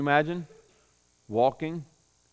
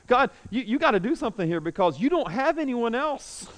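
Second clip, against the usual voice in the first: high-pitched whining complaint; whiny Elijah; 6 semitones higher than usual. Retold or imitated. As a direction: whine at God like a sulking child. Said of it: "that's his attitude".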